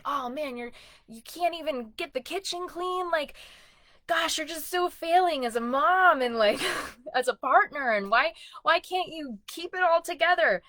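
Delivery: hammed-up self-scolding; mocking self-critic. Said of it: "my self-critic would have".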